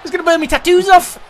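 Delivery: high, loud panicked cry; panicked yell. Real, not acted. Player yells as wrestler burns on table, panicked high shout. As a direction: shriek it like your back's burning. Character on fire, player yelling.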